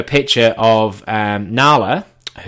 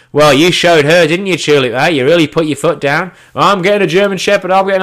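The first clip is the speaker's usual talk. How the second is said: loud, higher-pitched teasing; mocking mates; 8 semitones higher, 8 dB louder than usual. Mates ribbing him about the dog, mocking. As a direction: tease like mates who won't let it go.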